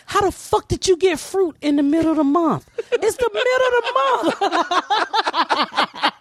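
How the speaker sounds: high, laughing disbelief at the bananas; shrill amazed child. Retold or imitated. Comic recalling her childhood self, high amazed squeal. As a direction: squeal it like a kid who can't believe it.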